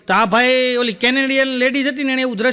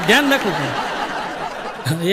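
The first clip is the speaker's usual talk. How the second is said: laughing slow moral; 5 semitones lower, much slower than usual. Real, not acted.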